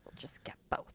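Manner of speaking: very soft, near-whispered quote; hushed, unbothered retelling; ever so calm. Retold or imitated. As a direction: imitate someone calmly settling it, barely voiced. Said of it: "he just ever so calmly".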